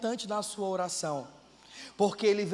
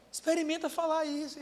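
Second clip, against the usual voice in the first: usual speaking voice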